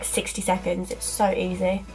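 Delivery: normal talking voice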